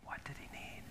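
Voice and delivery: hushed breathy question; whisper. Shown as a demonstration. Pastor drops to a whisper before the congregation. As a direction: whisper it to draw them in. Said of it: "talk like this the rest".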